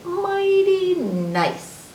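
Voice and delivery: very slow, high, stretched words; playful drawn-out reading. Acted. Reader relishing the end of an M rhyme, playful drawn-out reading. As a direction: draw each word way out up high.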